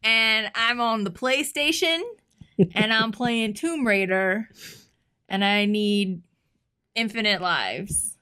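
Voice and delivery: put-on slow, loud grandpa with a Southern accent. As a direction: imitate grandpa's Arkansas accent, stating his request slowly. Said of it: "he's from Arkansas, so he has an accent".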